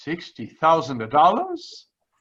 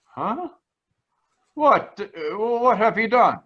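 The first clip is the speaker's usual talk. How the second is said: rising startled questions; startled gasp; 7 semitones higher than usual. Acted. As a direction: caught off guard at the sight. Startled at the treasure box.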